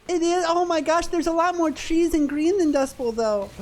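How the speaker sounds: ordinary talk, nothing marked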